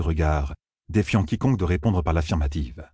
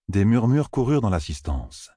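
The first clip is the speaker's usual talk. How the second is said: ordinary delivery, nothing marked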